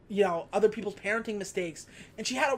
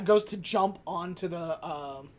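ordinary talk, nothing marked

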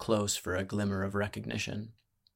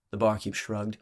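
usual speaking voice

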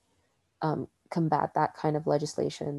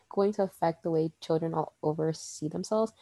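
normal talking voice

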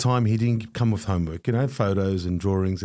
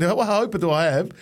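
higher, slow, despairing question; retold tearful despair; 7 semitones higher than usual. Student answering why he is crying, retold tearful despair. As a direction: retell a tearful plea, drawing it out. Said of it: "I'm started to cry".

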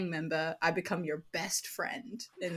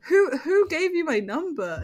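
higher, louder indignant demand; 7 semitones higher, 6 dB louder than usual. Retold or imitated. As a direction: challenge a stranger on the phone. Challenging the unknown caller, retold.

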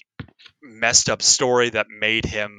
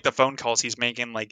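usual speaking voice

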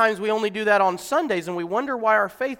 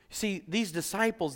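normal talking voice

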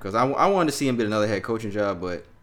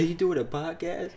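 higher, slow put-on voice; slow, higher mock caller voice; imitating the caller; 9 semitones higher, 3 dB quieter than usual. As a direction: drag it out like the caller on the phone. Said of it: "He said".

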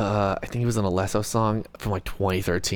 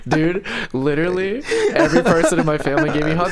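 laughing; 7 semitones higher, 3 dB louder than usual. Real, not acted. Laughing retelling family hugs.

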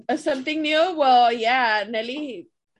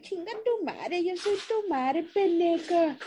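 put-on baby voice; 5 semitones higher, 3 dB quieter than usual. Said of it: "everyone does the baby voice".

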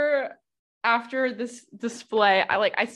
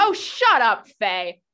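angry snapping; 6 dB louder, slower than usual. Acted. Saphria to Faye after her apology, angry snapping. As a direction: snap at a meddling friend.

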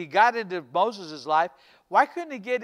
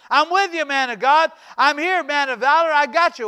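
higher, louder preacher's voice for the angel; angel's proclamation; 5 semitones higher, 7 dB louder than usual. Retold or imitated. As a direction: declare it boldly to Gideon. Angel addresses Gideon in retold scene.

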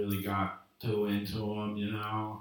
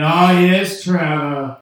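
greeting call; 6 semitones higher, 15 dB louder, slower than usual. Retold or imitated. Staff greeting him as regular.

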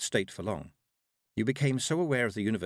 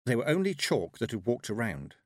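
usual speaking voice

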